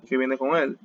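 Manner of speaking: usual speaking voice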